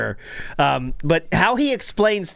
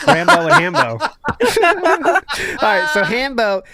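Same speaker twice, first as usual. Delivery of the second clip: laughing through the names; cracking up at a silly name; 9 semitones higher, 5 dB louder than usual. Real, not acted. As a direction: giggle it out, louder.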